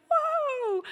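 very high exclaim; taken aback at the door; startled whoa. Retold or imitated. Pastor shocked to see his old friend. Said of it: "I'm like".

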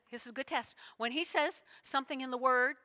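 usual speaking voice

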